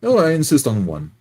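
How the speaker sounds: normal talking voice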